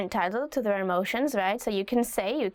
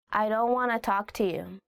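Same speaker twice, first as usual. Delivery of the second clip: usual speaking voice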